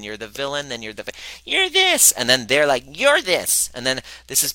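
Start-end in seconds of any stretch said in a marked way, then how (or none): 1.4-2.1 high, loud, finger-pointing outburst; accusing yell. Retold or imitated. Acting out two people blaming each other. 2.9-3.7 higher, louder snap-back at the accuser; accusing retort. Retold or imitated. Acting out the other side blaming back.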